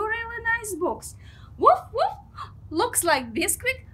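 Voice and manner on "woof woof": excited puppy yip. Pretend. Voicing the dog Biscuit.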